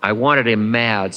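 usual speaking voice

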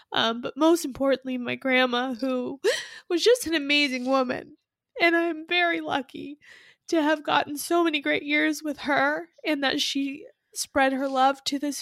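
emotional catch in the voice, laughing through tears; tearful. Real, not acted. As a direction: choke up while praising her. Tearful tribute to late grandmother.

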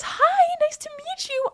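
squeaky-high put-on politeness; high-pitched greeting. Host imitating themselves meeting viewers, high-pitched greeting. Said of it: "I literally talk like this".

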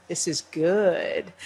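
slow, hushed private thought; inner thought. Retold or imitated. Hoda thinking to herself in the newsroom, slow and hushed. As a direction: savor it quietly to yourself.

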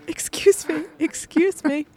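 high, timid excuse-me to a stranger; polite excuse me. Retold or imitated. Asking a woman by the taxis for help, high and timid.

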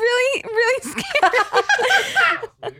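very high, breathless cry; shaken. Real, not acted. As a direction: squeal out how scary it was. Reacting to the fright.

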